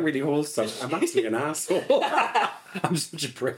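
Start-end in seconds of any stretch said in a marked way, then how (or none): 1.9-2.8 high laugh. Real, not acted. Laughing at herself.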